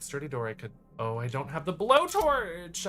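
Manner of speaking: usual speaking voice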